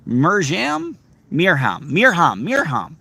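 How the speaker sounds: Meerschaum as mer-ZHAM, then Meerham as meer-HAHM; questioning Meerschaum, then singsong repeats of Meerham; practising a pronunciation. Shown as a demonstration. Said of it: "It must be Meerham".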